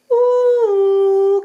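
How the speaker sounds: high, loud call into the hole; a little mouse hooting hello into a big hole. Acted. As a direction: call into the dark burrow. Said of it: "crie-t-elle".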